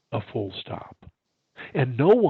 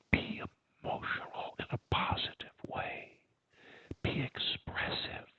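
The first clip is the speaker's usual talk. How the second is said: hushed expressive delivery; 9 dB quieter than usual. Shown as a demonstration. Demonstrating soft, slow, expressive speech.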